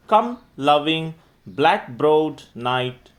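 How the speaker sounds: poem recitation. Acted. Teacher reciting Juliet's plea to night for students, declamatory recitation.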